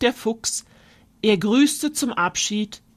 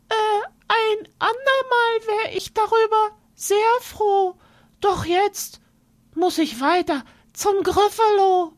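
high squeaky mouse, stammering; a tiny mouse stalling for time, much higher; nervous little mouse; 13 semitones higher than usual. Acted. Mouse politely refusing the fox, high and hesitant.

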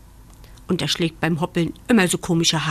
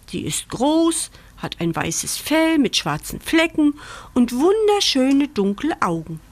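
high, fast rabbit repeating the description; eager echo; 6 semitones higher than usual. Acted. Rabbit repeating the puppy's description back to him, eager insistence. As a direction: insist the match is perfect.

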